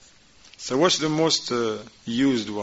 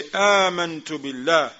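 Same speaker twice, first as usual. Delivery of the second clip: آمنت stressed, higher and a bit louder; emphatic declaration; 4 semitones higher, 4 dB louder, faster than usual. Shown as a demonstration. Teacher models the words against the whispers, emphatic. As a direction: say آمنت بالله firmly and quickly. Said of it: "you say".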